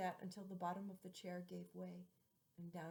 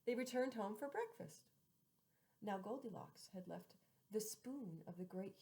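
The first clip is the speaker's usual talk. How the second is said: plain reading voice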